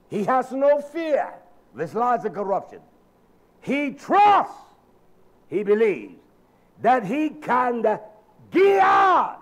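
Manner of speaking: rising to a full preacher's shout, slow on the key words; fiery preaching. Real, not acted.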